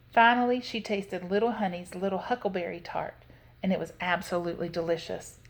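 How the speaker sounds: ordinary delivery, nothing marked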